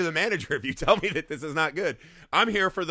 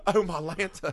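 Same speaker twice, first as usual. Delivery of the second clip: lightly put-on Southern belle accent; a hint of a fainting Southern belle; mock Southern belle. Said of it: "Wendy's now in a Tennessee".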